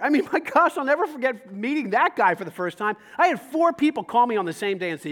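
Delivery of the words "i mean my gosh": ordinary talk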